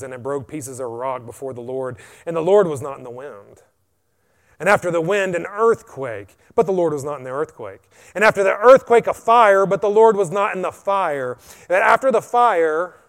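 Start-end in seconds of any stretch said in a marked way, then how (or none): 4.6-11.4 louder and higher as it builds; building intensity. Acted. Preacher building wind, earthquake and fire, loud. 11.7-12.9 louder and higher, slowed; loud buildup. Shown as a demonstration. Preacher building loud before the still small voice. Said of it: "a still small voice".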